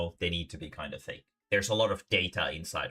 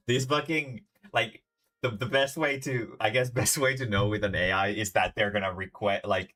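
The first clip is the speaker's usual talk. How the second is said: laughing while talking; 4 semitones higher, 4 dB louder than usual. Real, not acted. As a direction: talk through real laughter, higher and louder.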